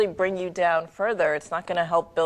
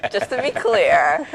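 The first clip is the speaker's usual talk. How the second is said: laughing; 8 semitones higher, 5 dB louder than usual. Real, not acted. Laughing at her own pun.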